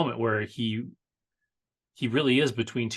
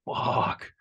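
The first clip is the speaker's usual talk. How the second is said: breathy, defeated "fuck" under the breath; a hushed, beaten "fuck"; whispered curse. Retold or imitated. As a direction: mouth the curse in resignation. Hopeless victim facing death.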